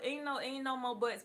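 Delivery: ordinary delivery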